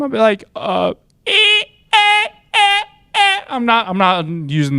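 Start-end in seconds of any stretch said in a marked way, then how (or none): 0.5-3.4 very high stammering eh. Retold or imitated. Stammering when asked for a name. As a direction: stammer helplessly. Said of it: "the fucking Heck voice".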